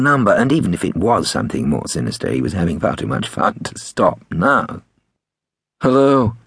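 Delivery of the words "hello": put-on higher, louder Liverpudlian accent. A narrator's reading. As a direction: greet them in your best Liverpudlian. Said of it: "in his best Liverpudlian".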